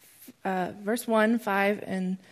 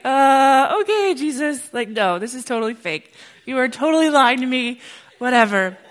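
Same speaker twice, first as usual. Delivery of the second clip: laughing through it, louder, half in tears; laughing disbelief; 4 semitones higher, 9 dB louder than usual. Retold or imitated. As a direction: scoff at it while laughing helplessly. Speaker retells laughing disbelief.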